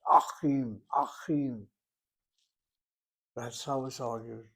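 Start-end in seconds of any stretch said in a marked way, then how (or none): 0.1-1.7 ACHIM loud, first vowel swallowed into a throaty ch; native-speaker gobbled Het. Shown as a demonstration. Teacher imitating a native speaker, forceful guttural Achim. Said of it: "gobbles it into the Het".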